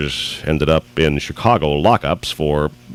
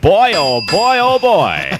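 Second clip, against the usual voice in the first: excited exclaim; 4 dB louder, much slower than usual. Real, not acted. Host cheering the radio contest winner, excited. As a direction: cheer the caller's win.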